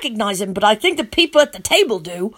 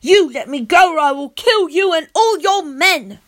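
higher, louder angry yell; angry threat; 7 semitones higher, 4 dB louder than usual. A narrator's reading. Boy threatening the vampire prince, furious. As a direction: threaten them all.

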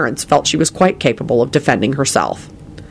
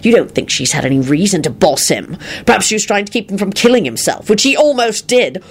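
angry retort climbing in pitch; threatening; 5 semitones higher than usual. A narrator's reading. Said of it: "he finished threateningly".

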